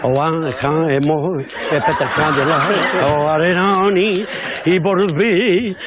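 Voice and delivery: low, slow camp drawl; lightly voiced camp caricature. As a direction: ham up a camp character. Camp character's falsetto gag.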